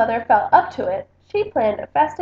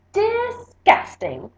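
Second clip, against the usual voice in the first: high and slow, dripping with distaste; a sneering cousin, slow and high; drawn-out disgust; 10 semitones higher, much slower than usual. A narrator's reading. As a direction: stretch it out like something smells bad.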